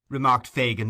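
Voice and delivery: usual speaking voice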